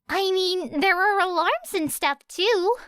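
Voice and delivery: put-on high, light girl's voice; Uraraka voice. A narrator's reading. Uraraka asking Era about locks, high voice. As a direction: lift the voice up for Uraraka.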